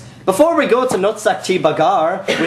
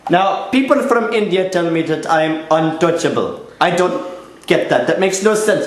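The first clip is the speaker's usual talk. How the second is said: put-on earnest, lilting Indian-accented reporter; Indian news reporter. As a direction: play an upbeat field reporter from India.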